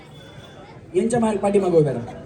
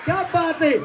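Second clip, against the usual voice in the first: delighted cheer; 9 semitones higher, slower than usual. Real, not acted. Host cheers contestant.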